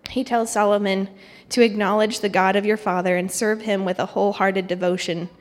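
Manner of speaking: usual speaking voice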